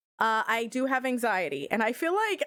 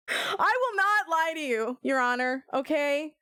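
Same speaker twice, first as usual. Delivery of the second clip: ordinary talk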